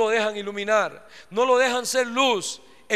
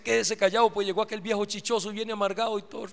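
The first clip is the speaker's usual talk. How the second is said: softer, fast hushed complaint; quiet grumble; 3 semitones lower, 7 dB quieter, faster than usual. Acted. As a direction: grumble it under your breath, quickly. Mom hushing kids about grumpy dad.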